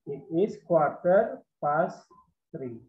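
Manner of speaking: quarter drawn out, each word slow; very slow model. Shown as a demonstration. Teacher introduces quarter to pupils, very slowly. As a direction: spell it out slowly for the class. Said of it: "seperempat itu dalam bahasa Inggris adalah quarter".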